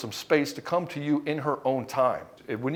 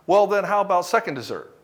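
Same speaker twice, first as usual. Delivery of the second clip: pushy eager host; 6 semitones higher, 6 dB louder than usual. Retold or imitated. Pushy host offering more food.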